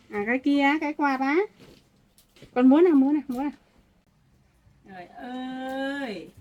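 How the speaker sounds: put-on loud, sing-song talk to a toddler; grandma playing and showing toys to a baby. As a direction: coax the baby, calling out brightly.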